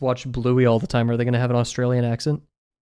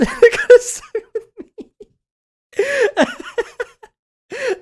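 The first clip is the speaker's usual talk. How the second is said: laughing; 5 dB louder than usual. Real, not acted. Laughing mid-sentence.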